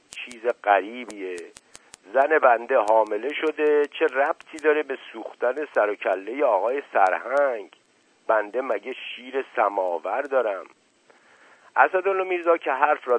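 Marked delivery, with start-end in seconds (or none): none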